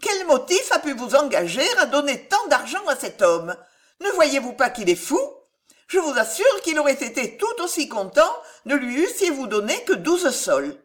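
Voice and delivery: agitated, high-pitched remonstrance; incredulous protest. A narrator's reading. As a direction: scold a friend in disbelief. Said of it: "s'écria madame Harel, qui avait peine à en croire ses yeux".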